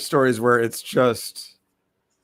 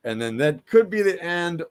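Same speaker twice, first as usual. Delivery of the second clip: ordinary talk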